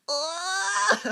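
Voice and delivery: high, loud mock wail of a crying kid. Ten-year-old bursts into tears at the blood, wailing. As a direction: burst into tears on one long oh. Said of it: "so instantly I went".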